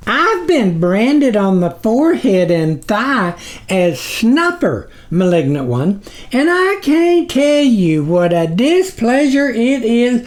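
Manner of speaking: high squeaky flattery; lightly voiced small figure, high and eager; groveling tiny creature. A narrator's reading. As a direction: fawn nervously over the sublord. Snuffer introduces himself.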